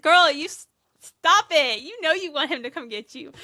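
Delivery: loud, high teasing scold. Retold or imitated. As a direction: call her out like a nosy friend. Teasing Sabrina about the phone call.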